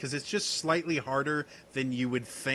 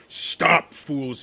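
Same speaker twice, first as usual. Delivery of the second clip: a loud, commanding shout to halt; booming command; 2 semitones higher, 8 dB louder than usual. Acted. Ranger shouting at the hunters to stop. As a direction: shout the order across the clearing. NPC shouts warning at party.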